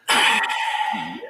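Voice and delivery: lightly imitated snigger then a sly yeah; imitated giggle. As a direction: giggle like the mate caught out. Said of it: "Luke is sitting there going".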